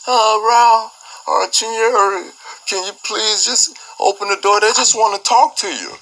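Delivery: high, sly imitation of the property manager; devilish voice. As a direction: coax in a mock-devilish high voice. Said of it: "in this devilish voice".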